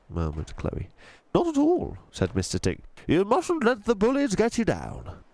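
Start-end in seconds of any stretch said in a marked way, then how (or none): 1.3-5.2 put-on gentle old tramp voice, a different timbre; old tramp Mister Stink. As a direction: speak like a kindly old vagrant.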